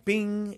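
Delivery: high-pitched ping of a light switching on; imitated "bing". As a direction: pop out a high little bing.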